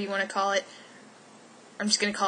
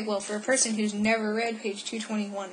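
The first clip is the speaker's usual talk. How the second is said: normal talking voice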